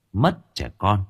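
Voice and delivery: ordinary delivery, nothing marked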